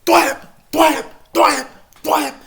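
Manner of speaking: loud, high mock hits; punch sound effects. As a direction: smack out each punch. Imitating punches in fight.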